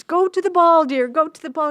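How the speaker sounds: storyteller's excitable fairy godmother voice; insistent fairy godmother. Retold or imitated. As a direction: shoo them off lovingly but loudly.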